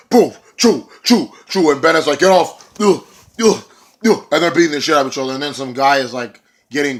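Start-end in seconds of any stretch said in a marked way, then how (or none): 0.1-1.7 loud punch noises; punch sound effects. Retold or imitated. Imitating punches. 2.2-4.3 loud, high grunts; pained grunts. Retold or imitated. Bennett being beaten up.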